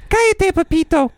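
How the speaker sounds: put-on high, sharp mom hushing a kid; mama's scolding voice. Imitating mama as the dog bothers her. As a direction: snap "Cállate, papito" like a mother hushing. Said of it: "mama says".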